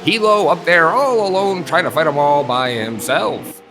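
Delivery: high, loud, building play-by-play shout; excited race call. Acted. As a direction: call the three-wide pass at full pitch. Close three-wide battle call.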